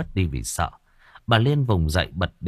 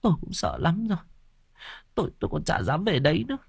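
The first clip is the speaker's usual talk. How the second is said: tearful; 7 semitones higher, 5 dB quieter, faster than usual. Acted. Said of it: "rơm rớm nước mắt".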